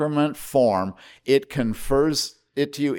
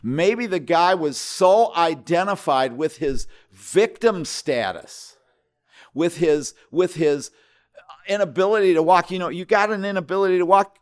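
rising, louder preaching push; 6 semitones higher, 5 dB louder than usual. Acted. Pastor challenging the congregation, preaching louder and higher. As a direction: press the point hard from the pulpit.

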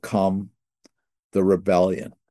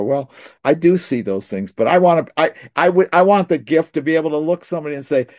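eager insisting; 7 semitones higher, 4 dB louder, faster than usual. Retold or imitated. Pastor insists on his wish.

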